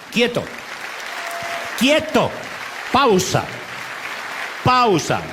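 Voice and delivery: loud, high single-word commands with pauses; orden gritada. Acted. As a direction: shout each word like halting someone in their tracks. Órdenes gritadas de parar, escenificadas. (órdenes a gritos, tajantes)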